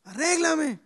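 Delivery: a lightly voiced talking house; the house calling. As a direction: play the old house begging for paint. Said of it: "la casita está gritando y dice".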